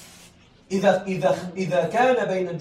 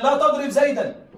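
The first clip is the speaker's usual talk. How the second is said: example called out; 5 semitones higher, 4 dB louder than usual. Shown as a demonstration. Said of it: "تقول لفلان".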